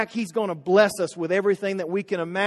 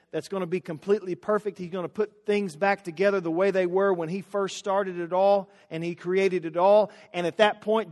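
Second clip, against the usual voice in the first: ordinary delivery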